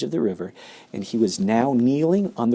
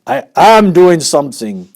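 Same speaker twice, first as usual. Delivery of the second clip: flustered father; 6 semitones higher, 10 dB louder than usual. Acted. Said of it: "said Mister Gloop".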